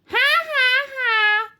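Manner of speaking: high, loud witchy "ha ha ha"; mocking laugh. A narrator's reading. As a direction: laugh at the pupil's feeble howl.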